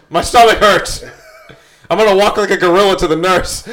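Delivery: high and breathless from laughing; after laughing hard. Real, not acted.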